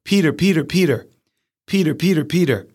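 high, repeated birdcall, falling on each "Peter"; titmouse song imitation. Shown as a demonstration. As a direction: sing out "Peter" like a little bird. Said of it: "they tend to sing and make a noise. They go".

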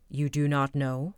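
cold, low disbelief; stern Bluebeard. A narrator's reading. Husband sternly echoing his wife's denial, low voice. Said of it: "said Bluebeard sternly".

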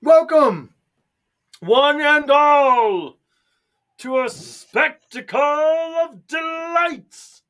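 booming, slow showman announcement; carnival barker. Pretend. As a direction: roll it out like a circus ringmaster.

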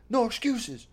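pumped-up gym bro preaching; hammed-up fitness influencer; fitness guy quote. Quoting fitness guy's motivational video.

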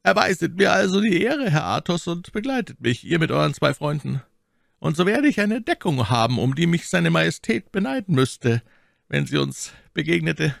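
high-pitched, courteous man of power; commanding cardinal. A narrator's reading. Voices the cardinal in narration.

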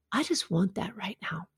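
a hushed unvoiced confession; whispered craving. Real, not acted. Coach confessing her fries craving, whispered. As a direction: breathe it like a guilty secret.

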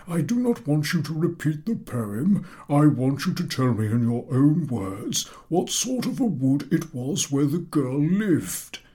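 slow, high, spelled-out instruction; patient-impatient professor. A narrator's reading. Professor explaining the task again, slow and high. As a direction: lay it out word by word.

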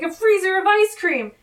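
high, loud imitation of excitement; pumped-up. Cohost acts out Carlos announcing his dessert island pick, pumped-up. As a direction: exclaim it like a hyped-up character. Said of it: "And he's very pumped about this".

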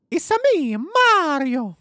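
high, bouncy Italian plumber voice; cartoon Mario catchphrase, way up high; Mario impression. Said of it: "can you do a Mario impression".

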